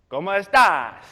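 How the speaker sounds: loud, emphatic Spanish greeting; acting out an eager learner; eager practice. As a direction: belt out the new phrase proudly.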